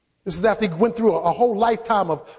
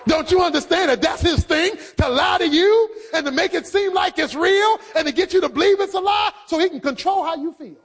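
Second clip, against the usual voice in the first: preaching at full force; 4 semitones higher than usual. Acted. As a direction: drive it home to the congregation.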